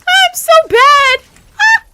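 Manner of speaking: high, loud, slow wail of defeat; frustrated shout. Real, not acted. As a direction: cry out at your own failure. Shouting at failure in game.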